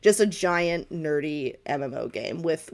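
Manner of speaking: ordinary talk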